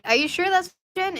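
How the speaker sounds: put-on high, puzzled kid asking; confused question. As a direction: sound honestly unsure. Retold confused kid reply.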